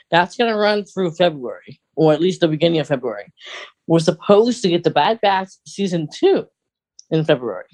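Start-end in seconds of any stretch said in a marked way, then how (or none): none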